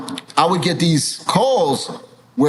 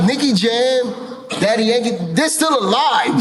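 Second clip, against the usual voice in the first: high-pitched, rising to a shout; retold argument, voice climbing in disbelief; exasperated protest; 5 semitones higher than usual. Retells his argument with the network.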